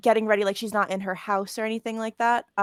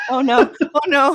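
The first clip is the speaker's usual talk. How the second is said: loud, high oh-no; playful dismay; 6 semitones higher, 11 dB louder than usual. Real, not acted. Player landed with the first roll, loud playful dismay. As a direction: cry out in comic regret.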